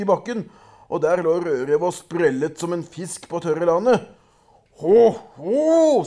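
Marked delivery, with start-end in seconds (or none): 4.8-6.0 troll laugh. A narrator's reading.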